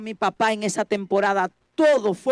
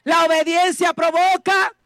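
shouted refrain; 6 semitones higher, 4 dB louder than usual. Shown as a demonstration. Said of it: "Dígalo conmigo".

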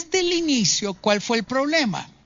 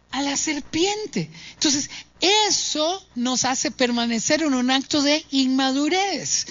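ordinary delivery

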